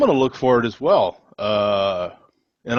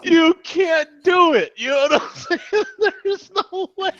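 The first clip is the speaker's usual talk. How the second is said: laughing. Real, not acted. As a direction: laugh till you nearly cry. Still cracking up.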